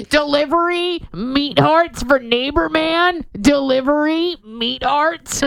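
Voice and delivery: loud, high yell of a delivery guy; hollering the order at the door; mock delivery yell. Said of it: "somebody willing to yell that".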